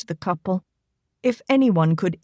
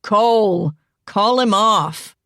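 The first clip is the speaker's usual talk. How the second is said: raised call over the dog's play; louder call to the husband; 4 dB louder, slower than usual. A narrator's reading. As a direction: call loudly, a bit slower. Calling over a jumping dog.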